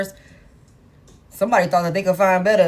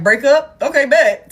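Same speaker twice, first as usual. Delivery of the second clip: higher and louder, breezy mock shrug; careless mock reply; 5 semitones higher, 7 dB louder, slower than usual. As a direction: toss it off like you couldn't care less. Acting ex's careless reply.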